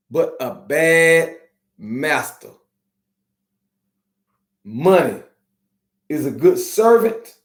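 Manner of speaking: slow, low, every word set apart; slow and deliberate. Shown as a demonstration. To his followers, slowly saying his mama's saying. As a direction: spell the saying out slowly so it sinks in. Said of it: "I'ma say it slow for you".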